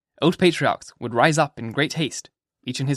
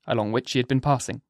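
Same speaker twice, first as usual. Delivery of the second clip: usual speaking voice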